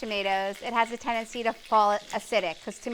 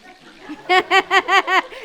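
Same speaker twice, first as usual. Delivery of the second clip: loud burst of laughter; 9 semitones higher, 8 dB louder than usual. Real, not acted. Laughing at Nazi joke.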